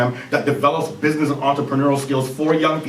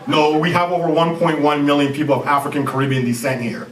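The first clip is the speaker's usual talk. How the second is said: normal talking voice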